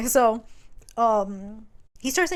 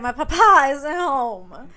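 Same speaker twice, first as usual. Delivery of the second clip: imitating the girl; 5 semitones higher, 8 dB louder, slower than usual. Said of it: "she's like".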